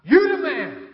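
high, loud fan's shout; shouted cheer. Retold or imitated. Boy in the golf crowd cheers the player, shouting. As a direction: holler it out like a fan on the course. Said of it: "you heard one boy shout out".

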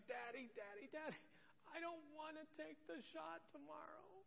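put-on high, soft little child's plea; imitating crying daughter. Toddler begging her dad at bedtime, imitated tearful pleading. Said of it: "she's crying. She's like".

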